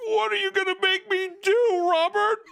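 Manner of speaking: hammed-up submissive plea; mock pleading Gene. Gene in chains asking Robert, very high. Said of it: "he's like".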